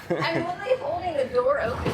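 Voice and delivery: laughing through the words while straining. Real, not acted. To friend teasing her about the door, laughing. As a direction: chuckle it out, half exasperated.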